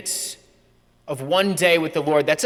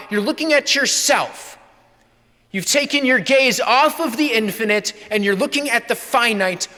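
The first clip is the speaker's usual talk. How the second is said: higher, louder preacher lecturing; priest's rebuke; 6 semitones higher, 3 dB louder than usual. Retold or imitated. Priest rebukes bored college student, raised and loud. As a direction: drive the point home like a fired-up priest.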